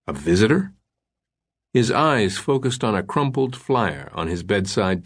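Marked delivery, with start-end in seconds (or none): 0.1-0.7 groggy question. Acted. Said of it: "Langdon still felt fuzzy".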